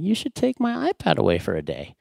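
put-on high-pitched kid voice; high, childlike suggestion; young son. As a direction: pipe up as a small boy offering a punishment. Imitates his son's suggestion.

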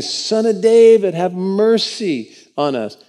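slow, pleading cry out for mercy. A narrator's reading. Blind men begging Jesus on the road, loud pleading cry. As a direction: call out high and slow, begging. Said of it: "crying out and saying".

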